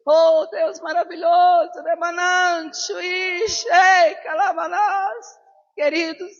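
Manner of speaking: loud, high, drawn-out glossolalia; ecstatic praise in tongues. Real, not acted. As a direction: cry out in tongues to God. (louvor em línguas, alto e arrastado)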